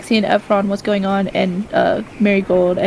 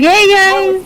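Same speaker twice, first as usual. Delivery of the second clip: cheerful call; 10 semitones higher, 5 dB louder than usual. Acted. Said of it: "I'm like".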